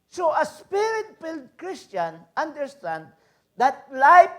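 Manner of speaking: loud, high, slow preaching. Acted. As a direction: drive home that life is precious. Preaching at full force. (malakas at mabagal na pangangaral)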